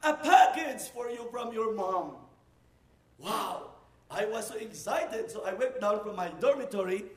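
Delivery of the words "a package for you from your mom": a woman yelling up to the dorm with news; calling out. Retold or imitated. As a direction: shout his name from a distance. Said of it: "heard her voice calling my name".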